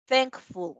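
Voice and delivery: "thankful" with a nasal ng before the k, thank-ful; English ng sound. Shown as a demonstration. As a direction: say "thankful" as thaŋk-ful, back of the tongue. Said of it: "Entonces sería".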